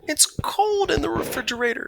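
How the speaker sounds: a high little voice, stretching out "cold"; small mouse voice. A narrator's reading. Stuart shut in the fridge, complaining in a high little voice. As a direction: sound like a tiny chilled mouse.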